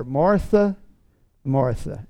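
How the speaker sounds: tender reassurance. Acted. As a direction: call her name kindly, not scolding. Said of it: "he's saying that tenderly".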